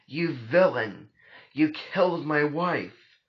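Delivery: slow, high, loud shout; loud cry at a killer; shouted accusation. A narrator's reading. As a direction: shout the accusation, drawing out each word. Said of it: "My shouting brought a crowd".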